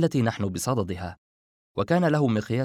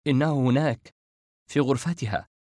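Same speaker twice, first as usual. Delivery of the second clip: usual speaking voice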